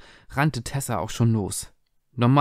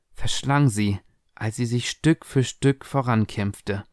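usual speaking voice

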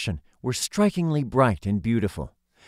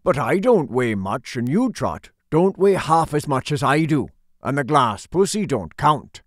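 put-on a touch of gruff old sailor, louder; lightly growled old seaman lines; gruff old fisherman; 3 semitones higher, 4 dB louder than usual. A narrator's reading. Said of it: "said the old sailor".

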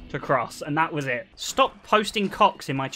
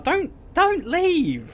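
high-pitched playful plea; mock pleading; 12 semitones higher, much slower than usual. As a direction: beg them to stay, half in jest. Begs viewer not to leave.

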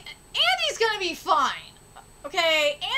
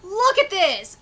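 normal talking voice

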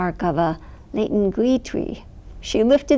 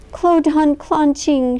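higher, louder, slow calling; mother calling frantically; shouting; 7 semitones higher, 5 dB louder, slower than usual. A narrator's reading. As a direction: call out searching. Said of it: "she shouted".

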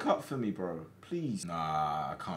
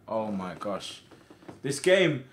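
ordinary delivery

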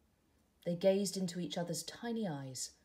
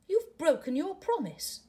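much higher and louder, a wounded caterpillar; hurt accusation; 11 semitones higher, 8 dB louder than usual. Acted. Caterpillar to tadpole, accusing him, higher and louder. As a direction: throw the broken promise back at him.

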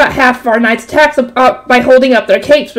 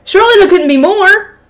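ordinary delivery

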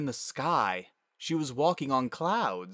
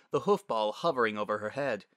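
usual speaking voice

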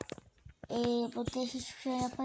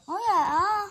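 surprised shout; 6 semitones higher, 8 dB louder than usual. Real, not acted. Surprise at game object.

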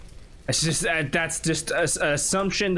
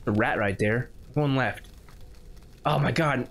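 ordinary talk, nothing marked

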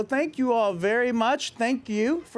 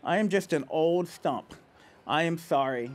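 put-on reading to children; 5 semitones lower than usual. A narrator's reading. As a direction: let the sad ending settle gently. Reads picture book to kids.

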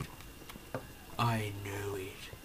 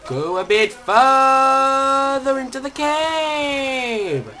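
higher, louder, slow manic shout; going mad; 12 semitones higher, 11 dB louder, much slower than usual. Pretend. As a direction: bellow it like a madman. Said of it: "I think I'm going a bit mad".

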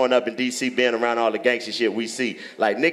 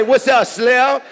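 higher, louder mock greeting; mimicking his greeting; 11 semitones higher, 5 dB louder, much slower than usual. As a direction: parrot the guy's greeting back, pitched up. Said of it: "He say".

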